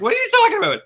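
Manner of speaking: high, loud, laughing disbelief; mock bewilderment. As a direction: squeal it in baffled amusement. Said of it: "I'm like".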